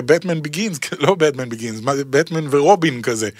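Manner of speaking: ordinary talk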